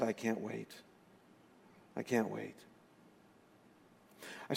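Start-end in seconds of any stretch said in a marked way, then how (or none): 0.0-2.5 much softer, trailing, weak; a dying man's soft longing; faint and quiet. Retold or imitated. Dying friend telling the pastor he longs for heaven, faint.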